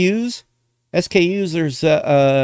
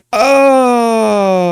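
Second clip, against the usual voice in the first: drawn-out, higher, falling "oh"; long loud sigh; 5 semitones higher, 5 dB louder than usual. Real, not acted. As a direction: let out one long, loud "oh". Long loud vocal noise after joking.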